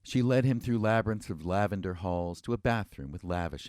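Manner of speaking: usual speaking voice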